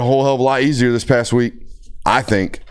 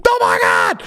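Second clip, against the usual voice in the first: very high-pitched mock scream; imitated scream. Mom finding her child knocked out, imitated scream. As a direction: shriek it like a panicking mother. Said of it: "Like, screaming above me".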